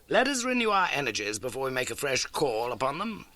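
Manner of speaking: crisp, keen detective laying out facts; Holmes voice. Acted. Holmes briskly telling Watson of the old case. Holmes considers the theory, then supper.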